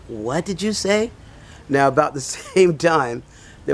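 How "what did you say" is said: high, slow incredulous question; retold disbelief. Passerby questioning the beggar who refused him, high-pitched disbelief. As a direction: draw it out as if you can't believe your ears.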